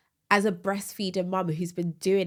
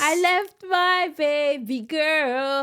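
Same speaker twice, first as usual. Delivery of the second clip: mock lament; 8 semitones higher, 6 dB louder, much slower than usual. Dramatic lament about leaving baby.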